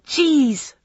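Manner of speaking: understated narrated yell; mild yell. A narrator's reading. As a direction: call it out sharply without full volume. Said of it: "I yelled at her".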